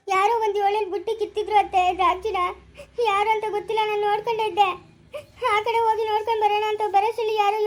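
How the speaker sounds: tearful, choking on the words; weeping while explaining; crying. Acted. Girl explains through tears.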